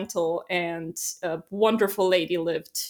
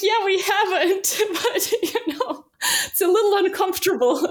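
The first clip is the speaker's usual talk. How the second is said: laughing; 11 semitones higher, 4 dB louder than usual. Real, not acted. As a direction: admit it while cracking up. Laughing at the awkward neighbor remark.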